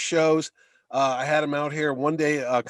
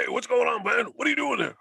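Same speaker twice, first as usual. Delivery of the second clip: Mister T voice; 7 semitones higher than usual. Retold or imitated. Greeting fans at the convention as Mister T, barking. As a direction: boom it out like the impersonator in character. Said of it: "and he does the voice".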